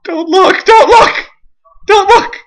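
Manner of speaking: high, urgent cries; panicked warning. Real, not acted. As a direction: plead in a high-pitched panic. Frantic warning in game.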